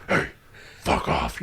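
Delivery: low-volume raspy threat, hammed up; mock-menacing Batman voice; Batman-like growl. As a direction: growl it like a menacing tough guy. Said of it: "Batman and".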